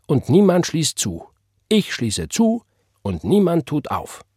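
high-pitched, emphatic scripture recital; fervent proclamation. Acted. Church sister completing God's word, proclaiming. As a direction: declaim each clause like a preacher.

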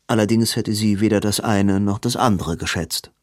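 ordinary delivery, nothing marked

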